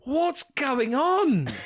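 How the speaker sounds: high, very drawn-out baffled wail; mock bewilderment. Listener still lost at this point in the show, mock bewildered. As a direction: stretch out the confusion.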